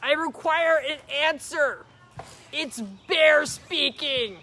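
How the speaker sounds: high, loud, slow shouting of the name; very loud call. Acted. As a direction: call out to Owl at the top of your voice. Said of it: "he called out in a very loud voice".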